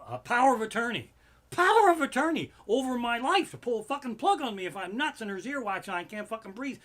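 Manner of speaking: loud, high outraged rant; angry rant. Real, not acted. Ranting to fans about the greedy agent's contract. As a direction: rail against the cocksucker agent.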